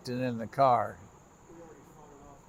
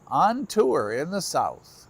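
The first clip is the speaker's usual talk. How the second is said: slow drawl; 3 semitones higher, slower than usual. Real, not acted. Drawn-out outro tagline.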